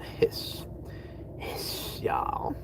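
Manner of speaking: lightly voiced hissing cat with a Southern twang; soft, voiceless hiss as a Southern cat. Joking as his Southern cat for viewers, lightly put-on Southern hiss. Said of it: "southern cat with a southern accent".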